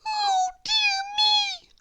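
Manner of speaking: very high fretful squeak, a bit slow; worried little mouse. A narrator's reading. Mouse despairing at the sleeping man. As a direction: sigh it in a tiny mouse voice. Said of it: "thought John Mouse again".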